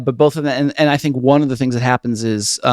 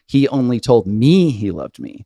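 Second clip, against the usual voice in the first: first "me" raised and stretched; stress on ME. Shown as a demonstration. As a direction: hit the me straight after told. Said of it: "put the emphasis on every single line".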